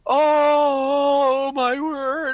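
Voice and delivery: high, hammed-up tearful gasp; a parody of sobbing at an ad; mock weepy gasp. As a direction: ham up being moved to tears. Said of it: "it was just like".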